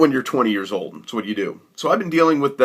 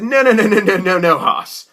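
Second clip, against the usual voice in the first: higher repeated no's, cutting him off; rapid mock protest; 8 semitones higher, 4 dB louder than usual. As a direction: stop him with a string of no's. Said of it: "And I said".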